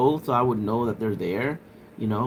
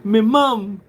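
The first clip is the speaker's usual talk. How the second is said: loud, high mock British "Me mum"; mock British accent; 8 semitones higher, 7 dB louder, much slower than usual. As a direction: repeat it like a Cockney. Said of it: "You guys say mum".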